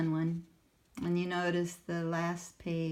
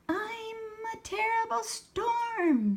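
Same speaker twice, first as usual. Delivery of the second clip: much higher excited yell; Sammy yelling; 6 dB louder than usual. Acted. Sammy yells as he dances as the storm, loud and high. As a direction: roar it like a storm. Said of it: "Sammy yelled".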